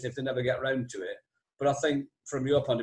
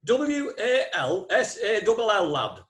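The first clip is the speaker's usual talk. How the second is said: slow, letter-by-letter spelling of W A L S A L L, higher; spelled out; 6 semitones higher, 3 dB louder, slower than usual. Retold or imitated. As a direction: spell "W A L S A L L" out slowly, then a dry "lad.". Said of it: "And he went".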